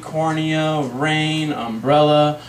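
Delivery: words droned on one level note; droning mental list; wandering train of thought. Acted. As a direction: let each thought slide into the next, monotone. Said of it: "My mind went dermatology".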